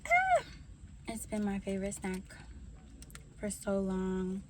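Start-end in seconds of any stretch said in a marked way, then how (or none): 0.1-0.4 quick high startled yelp; a sharp surprised cry; sudden yelp. Real, not acted. Sudden cry while eating.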